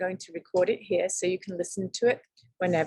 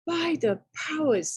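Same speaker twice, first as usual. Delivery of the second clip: surprised exclamation; 7 semitones higher than usual. Acted. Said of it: "thought Tom".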